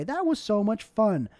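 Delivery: ordinary delivery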